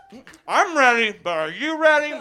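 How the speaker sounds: ordinary talk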